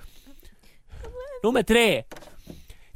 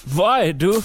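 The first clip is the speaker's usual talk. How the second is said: much lower, sluggish, halting voice of a man feeling ill; deep sickly stammering phone message; sick groggy caller; 9 semitones lower than usual. Acted. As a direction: mumble like your stomach is churning. Sick voice held.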